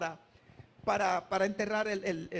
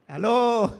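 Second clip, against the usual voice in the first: loud call to the room; louder hello to the crowd; 7 dB louder than usual. Real, not acted. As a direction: shout it to wake them up. Calling out to congregation.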